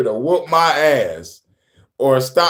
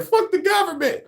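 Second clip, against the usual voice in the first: much higher squeal of a crowd chant; mock protester yell; 3 dB quieter than usual. Imitating protesters yelling.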